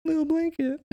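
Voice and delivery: high mock teasing voice. Friends teasing about Clark's blanket, high mock coo. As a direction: coo it like baby talk.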